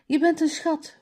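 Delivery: high, happy-thanks exclamation; calling out. A narrator's reading. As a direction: call it out, pitched well up. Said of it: "roept Mala". (hoog uitgeroepen)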